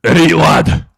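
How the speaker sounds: loud hammed-up Scottish accent; loud put-on Scottish accent; mock Scottish accent. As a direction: bark it like a Scottish chieftain. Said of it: "not even an Irish, Scottish accent".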